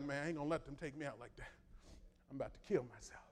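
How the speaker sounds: muttered jailer. Retold or imitated. Jailer voiced in the retelling.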